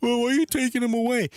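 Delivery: high, whiny mock voice of protesters; protesters' plea mocked in a whiny falsetto; mocking whine. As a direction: squeal it like a mocking complaint. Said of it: "They're crying like".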